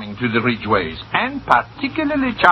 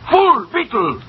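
higher, sharp angry name-calling; angry insults; 8 semitones higher, slower than usual. Acted. Victor scolding his embezzling bookkeeper, angry and higher. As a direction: spit the insults at him.

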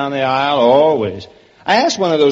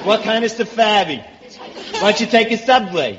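high, needling Brooklyn voice; Brooklyn accent; 5 semitones higher than usual. Acted. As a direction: play the hammed-up Brooklyn native. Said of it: "let's get out of Brooklyn".

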